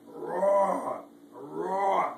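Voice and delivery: loud roaring tiger; booming playful tiger roar. Acted. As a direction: roar it out big for a child. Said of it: "But the tiger said".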